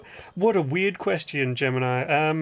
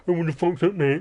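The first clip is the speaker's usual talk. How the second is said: put-on rough English chav accent; 3 semitones higher than usual. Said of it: "I'm not like a chav".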